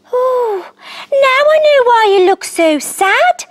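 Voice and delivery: high, quick little frog; gentle tiny frog realising. A narrator's reading. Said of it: "said Bebop".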